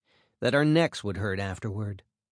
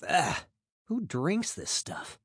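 disgusted; 7 semitones higher, 4 dB quieter, slower than usual. Retold or imitated. First swig of whiskey.

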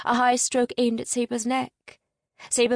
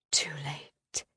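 softer, lower dying whisper; faint whisper; 6 semitones lower, 8 dB quieter than usual. A narrator's reading. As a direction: barely breathe it, lower still. Repeated despairing thought, fading.

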